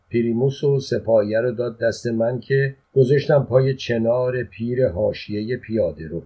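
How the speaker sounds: plain reading voice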